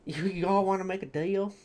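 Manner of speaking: playful put-on southern accent; southern devil, lightly hammed-up. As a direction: offer a bargain in a mock southern accent. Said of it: "Gotta love a southern accent".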